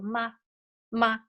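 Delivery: short, light "ma" without a tone; neutral tone. Shown as a demonstration. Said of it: "the last one is neutral tone".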